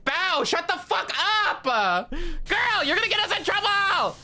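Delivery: very high, strained mock yell; panicked scolding. Graduates hush Bao before she gets them in trouble, shrieking. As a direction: shriek at a friend to stop talking.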